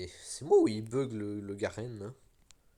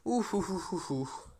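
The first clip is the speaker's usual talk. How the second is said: relieved ouf; 8 semitones higher, slower than usual. Real, not acted. Narrow escape in game.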